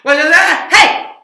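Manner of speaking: high, shouted garbled blur; frantic gabble ending in a yell; absurdly fast. Shown as a demonstration. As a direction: cram the song into a yelled burst. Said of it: "that was pretty fast".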